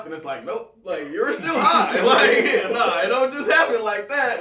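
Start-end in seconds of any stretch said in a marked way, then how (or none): 0.5-2.0 laughing retold voice. Brain's retold answer, laughing. 2.0-4.4 laughing. Real, not acted. Laughs at own story.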